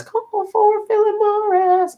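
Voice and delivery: very high, slow, stretched-out commercial call; exaggerated falsetto paging call; Lucy. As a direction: imitate a bellboy's cry in a squeaky high voice. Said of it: "Lucy does a T V commercial".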